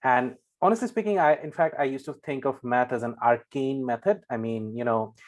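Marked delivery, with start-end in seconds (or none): none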